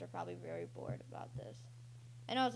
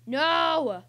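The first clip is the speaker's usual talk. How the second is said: loud, high-pitched cry of "no"; frustrated yell; 7 semitones higher, 12 dB louder than usual. Real, not acted. Player losing to Eddie the Master, frustrated yell. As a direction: yell out in defeat. Loses to rival in game.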